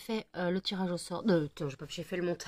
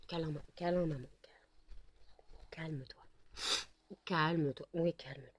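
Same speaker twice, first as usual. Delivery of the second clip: soothing hush; 2 semitones lower, 7 dB quieter than usual. Real, not acted. Soothing an overexcited dog.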